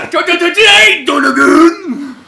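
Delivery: high, loud stuttering shout; a flustered kid tripping over the word. Retold or imitated. Stuttering kid calling out to Junior, high loud shout. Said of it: "like that kid".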